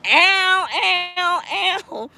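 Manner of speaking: high delighted cry of ow; excited squeal. Real, not acted. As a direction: squeal with joy at the praise. Reacting to fan reading her book.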